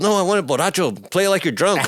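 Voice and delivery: high, insistent demand; mock director. Director asking the guitarist to play drunk, high and insistent. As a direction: push for drunken sloppiness.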